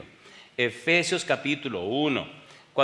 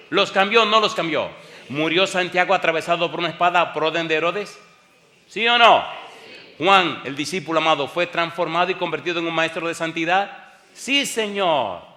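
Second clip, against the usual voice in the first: fiery preaching; 4 semitones higher, 6 dB louder than usual. Acted. Rhetorical questions to congregation.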